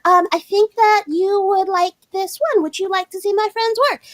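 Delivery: high, shy, hesitant made-up voice; put-on friend voice. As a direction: pitch it way up and ask timidly. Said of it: "I'm gonna give her this voice".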